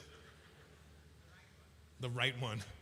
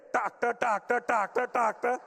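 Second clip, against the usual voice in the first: louder rhythmic "tractor, tractor" chant; mimicked indicator; 4 semitones higher, 6 dB louder than usual. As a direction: repeat "tractor" like a clicking blinker. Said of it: "What are you hearing?".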